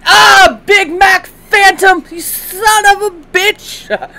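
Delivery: high, slow, drawn-out full shout; furious yell. Real, not acted. Player cursing Big Mac Phantom, furious yell. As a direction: yell it out, stretching every word. Yelling at opponent in game.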